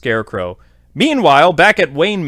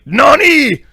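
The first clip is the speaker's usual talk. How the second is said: shocked nani; 11 semitones higher, 5 dB louder than usual. Retold or imitated. Dick shocked at Crane news.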